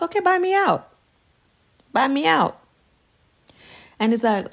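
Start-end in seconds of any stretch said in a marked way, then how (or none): 0.0-2.6 higher, daring challenge; defiant. Retold or imitated. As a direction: dare him to pay up. Challenging husband over house.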